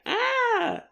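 high-pitched startled "ah"; mock yelp of pain. [name] as his hand gets stepped on, mock yelp.

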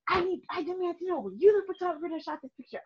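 high-pitched, indignant mock demand; angry husband. Upset husband confronting the photographer, angry imitation. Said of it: "all the anger".

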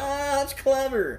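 high, slow, drawn-out amazement; impressed. Retold or imitated. Remembering his impressed reaction to her trick, high and slow. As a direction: stretch it out, marvelling.

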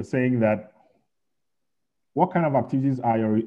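ordinary talk

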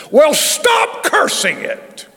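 slow, high, ringing rebuke; a hammed-up booming heavenly rebuke; voice of the Lord. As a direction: proclaim it slowly like a divine correction. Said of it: "here's what the Holy Spirit said".

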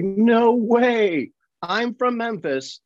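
high, incredulous protest; retold disbelief. Stunt coordinator fooled by the accent, high-pitched imitated disbelief. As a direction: imitate an amazed doubter.

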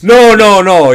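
loud protest. Real, not acted. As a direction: burst out loud and high. Loud protest, wasn't heard.

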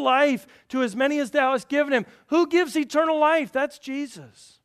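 loud, high, emphatic sermon delivery; full-force preaching. Real, not acted. Preacher proclaiming the authority of Jesus, loud and forceful. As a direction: proclaim it to the whole congregation.

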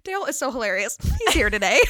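loud imitation breaking into laughter; pretend conversation. Pretending the absent coworker is in, laughing pretence. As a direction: play the fake chat, laughing through it. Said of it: "she pretends to have a conversation with him".

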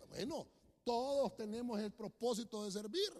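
ordinary delivery

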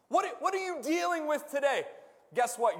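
ordinary talk

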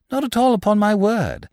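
light reassurance. A narrator's reading. Lupin lightly answers his would-be killer.

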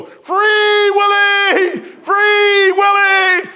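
from the back of the courtroom, urging the judge to free his friend, long high shout; imagined courtroom shout. Pretend. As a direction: bellow it across the courtroom, stretching each word. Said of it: "I just wanna go".

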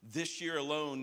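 usual speaking voice

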